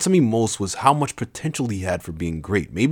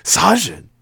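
high, loud startled shout; 12 semitones higher, 7 dB louder than usual. A narrator's reading. Narrator startled by Sajin's voice, shouting. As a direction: cry out the name. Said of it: "I shouted".

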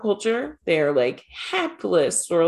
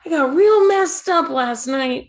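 laughing mock hungover; 7 semitones higher, 6 dB louder than usual. Mocking Ash as hungover.

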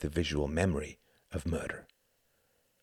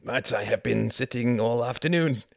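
higher, louder surprised protest; comic surprise; 6 semitones higher, 5 dB louder than usual. A narrator's reading. The Count answers Jorgen's order to sit, comically surprised. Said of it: "face almost comically surprised".

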